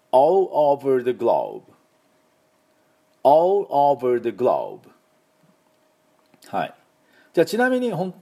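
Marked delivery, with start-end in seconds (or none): 0.2-4.8 all over the globe very slowly, each word clear; slow model phrase. Shown as a demonstration. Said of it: "私の後で発音いきましょう".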